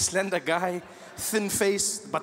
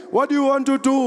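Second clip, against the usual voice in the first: rally call; 6 semitones higher, 5 dB louder than usual. Retold or imitated.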